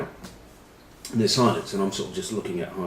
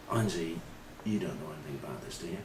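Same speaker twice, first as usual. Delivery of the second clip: quiet probe; 3 semitones lower, 12 dB quieter than usual. Retold or imitated. Quietly probing teammate at table.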